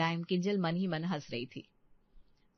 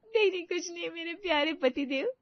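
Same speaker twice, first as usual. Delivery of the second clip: laughing; 8 semitones higher than usual. Acted. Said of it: "ऐसे क्यों हंस रही हो".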